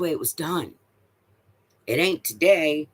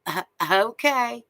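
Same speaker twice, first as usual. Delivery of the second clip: mock airhead reply; 10 semitones higher, slower than usual. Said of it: "She goes".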